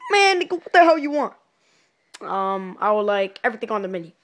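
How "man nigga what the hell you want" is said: loud, high rude demand; rude shout. Retold or imitated. Cashier yelling at a customer, loud and rude. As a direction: bark it at the customer. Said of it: "I'm like".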